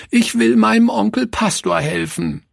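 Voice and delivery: put-on high, tearful cry of a small girl; little girl wailing at the pastor; sobbing girl. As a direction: cry out, near tears. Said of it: "rief sie schluchzend".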